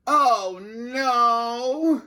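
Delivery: high, dismayed groan. A narrator's reading. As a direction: groan it in despair. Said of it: "groaned the principal".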